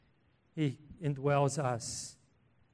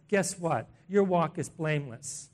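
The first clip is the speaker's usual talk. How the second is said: ordinary delivery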